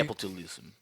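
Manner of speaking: capitalism said low and soft, Russian style. Shown as a demonstration. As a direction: drop capitalism low and quiet. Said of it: "I really love fucking saying it like that".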